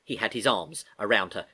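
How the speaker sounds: plain reading voice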